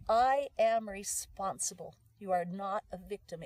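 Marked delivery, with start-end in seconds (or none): 0.1-2.0 slow, with "I" drawn out and louder; stress on I. Shown as a demonstration. Coach models the responsibility affirmation, stressing "I". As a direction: lean hard on the word "I". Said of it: "the emphasis is on the I".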